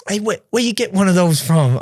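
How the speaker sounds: high-pitched imitated Texan; Texas Southern accent. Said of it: "I'm trying to do the accent here".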